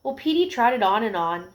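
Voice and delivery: plain reading voice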